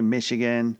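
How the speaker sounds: normal talking voice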